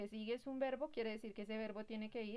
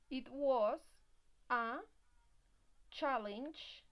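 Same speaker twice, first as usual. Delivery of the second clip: slow dictation; 3 semitones higher, 5 dB louder, much slower than usual. Shown as a demonstration. Teacher dictating English example.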